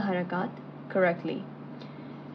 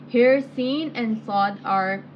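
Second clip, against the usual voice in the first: ordinary delivery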